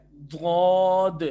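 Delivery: دھود loud, slow, mouth rounded and full; thick dhood. Shown as a demonstration. Tutor showing how thick the sound should be. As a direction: make دھود fat and heavy. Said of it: "اور موٹا کریں".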